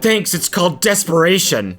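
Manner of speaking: high, pushed mimicry; hammed-up mock flirt; mocking replay. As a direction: parody the awkward reply, high. Mocking replay of his reply.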